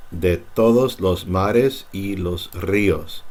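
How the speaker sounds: slow reading, ríos with a trilled R; slow model reading. Shown as a demonstration. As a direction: read it slowly with a rolled R in ríos. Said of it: "this is also like the double R".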